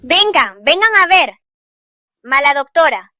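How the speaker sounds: loud public call with sweeping pitch; making a scene. Acted. Angry mother summons onlookers against the doctor, making a scene. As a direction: call everyone over to denounce her. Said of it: "hacer un escándalo así".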